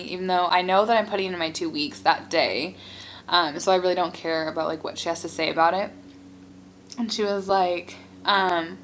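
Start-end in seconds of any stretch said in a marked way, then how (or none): none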